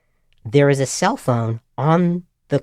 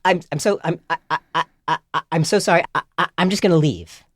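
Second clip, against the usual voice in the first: flustered stammering; 3 semitones higher, faster than usual. Retold or imitated. Student escaping the berating phone owner, flustered stammer. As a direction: stutter on each start, then hurry out. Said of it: "stumbling all over myself".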